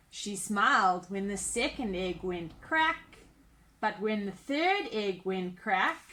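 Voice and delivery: put-on lively storybook narration for kids; animated picture-book reading voice; reading to children. A narrator's reading. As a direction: read it like bedtime story time. Reads a children's story aloud.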